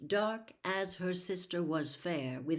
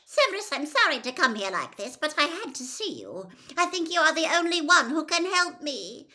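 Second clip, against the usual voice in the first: fast, higher, pleading rush; 9 semitones higher, 9 dB louder, faster than usual. A narrator's reading. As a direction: blurt it out urgently. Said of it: "she said in a rush".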